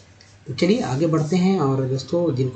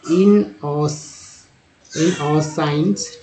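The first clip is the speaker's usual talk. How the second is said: first syllable stretched way out; very slow reading; much slower than usual. Shown as a demonstration. Teacher sounding out a hard word, very slow. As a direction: drag out the start, then say it. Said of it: "slow word demo".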